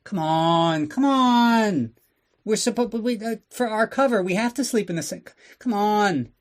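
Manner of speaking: put-on high whiny pleading, like a sulky kid; pouting Bond. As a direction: beg her like a spoiled child. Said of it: "He starts pouting, like a kid".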